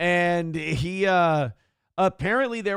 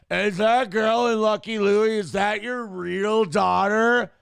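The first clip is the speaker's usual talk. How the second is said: put-on slow, loud, higher drunken question; a drunk buddy pestering a comedian; imitating drunk friend; 6 semitones higher, 6 dB louder, slower than usual. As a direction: ham up a sloppy drunk fan.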